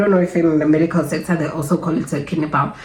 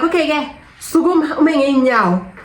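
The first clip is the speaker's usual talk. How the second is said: mock nurse order; 8 semitones higher than usual. Nurse ordering her to stand.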